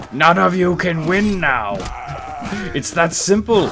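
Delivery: loud sinister taunt; menacing villain. Acted. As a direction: lord it over the beaten army. Gloating as Death over enemies.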